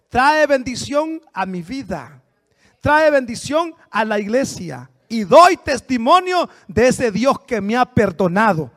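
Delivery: slow and weighty; slow solemn testimony. Real, not acted. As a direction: lay each word down slowly. Preacher slowing to testify. (testimonio lento y solemne)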